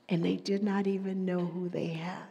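soft hushed whisper. Acted. Preacher whispering the point to her listeners. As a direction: lean in and whisper it.

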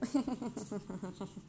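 soft rippling giggle; long, soft chuckle. Real, not acted. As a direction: giggle quietly at your own confession. Laughs at own confession.

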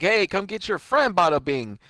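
lightly hammed New York tough guy; mobster taunt. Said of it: "he's like".

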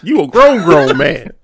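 high, forceful comic reprimand; mock scolding. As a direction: scold a friend in playful outrage. Retells scolding Walter for the word.

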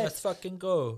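high, loud mock cheer; drunk hype. Friend echoes the drunk lads' rallying cry, mock cheer. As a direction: shout it like a lad on a night out. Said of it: "echoing the drunk lad".

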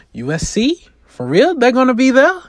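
can't believe the news; excited disbelief. Acted. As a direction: Claire thrilled her dream school will be watching. Hearing a college scout comes.

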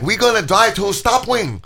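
put-on high-pitched, slow Chinese-accented girlfriend; mock Chinese accent. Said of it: "She's like".

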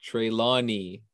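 Trelawney said slowly, first syllable like tray; slow careful Trelawney. Shown as a demonstration. Correcting a friend's Trelawney, slow and careful.

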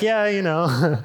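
put-on nonchalance; acting mature. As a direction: imitate yourself playing it cool. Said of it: "try to act, like, mature".

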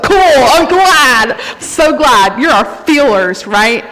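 loud, drawn-out put-on cheer; mock enthusiasm. Non-feeler preacher congratulating the emotional ones, mock enthusiastic.

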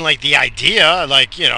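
usual speaking voice

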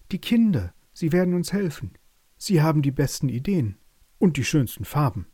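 high, eager mouse voice; little meadow mouse. A narrator's reading. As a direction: squeak out a plea with hope.

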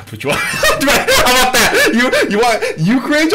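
high, loud words through laughter; helpless laughter. Real, not acted. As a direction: crack up while talking. Cracks up at request.

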